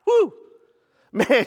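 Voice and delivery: usual speaking voice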